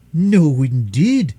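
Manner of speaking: loud indignant refusal. Acted. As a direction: flatly forbid it, indignant and loud. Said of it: "said the nurse indignantly".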